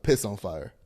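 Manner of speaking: ordinary delivery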